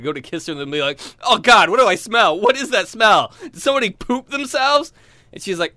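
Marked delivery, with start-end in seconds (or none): 1.2-4.9 loud disgust. Retold or imitated. Disgusted sniffing out smell.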